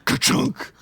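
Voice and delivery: ordinary delivery, nothing marked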